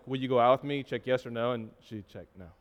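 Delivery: quietly crushed recalling the rejection. Retold or imitated. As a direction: let it fall to almost nothing, heartbroken. Heartbroken story low point.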